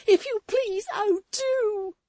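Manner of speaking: put-on high, loud, drawn-out desperate girl's voice; Susan's frantic plea. A narrator's reading. Maid begging Walter from the coach, frantic high wail. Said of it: "said Miss Nipper".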